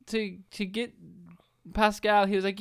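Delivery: ordinary talk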